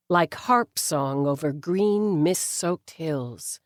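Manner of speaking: plain reading voice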